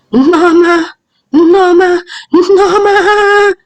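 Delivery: high-pitched, drawn-out angry call; angry yell. A narrator's reading. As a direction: yell the name like an angry mother summoning her daughter. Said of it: "she yelled".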